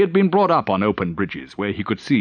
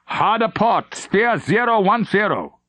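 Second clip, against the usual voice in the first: command; 10 semitones higher than usual. Acted. Captain orders helm.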